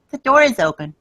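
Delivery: put-on sing-song like a ghost child copying the nanny; little girl voice. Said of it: "you hear a little girl".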